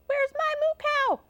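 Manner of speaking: high-pitched farmer's outcry; high, alarmed question; upset farmer. Acted. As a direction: call out high for your lost cow. Upset farmer hunts missing cow.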